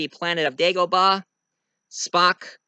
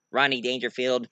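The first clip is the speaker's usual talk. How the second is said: plain reading voice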